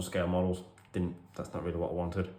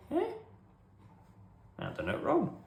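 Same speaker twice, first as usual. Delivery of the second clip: high-pitched puzzled squeak; confused. Retold or imitated. Boy hearing the accusation, confused. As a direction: sound baffled and lost. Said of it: "And I'm thinking".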